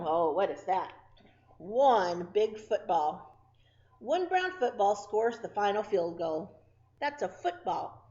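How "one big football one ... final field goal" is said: loud, slow storybook read; lively picture-book reading; reading to children. Acted. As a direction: read each word big for small kids. Reading counting book to kids.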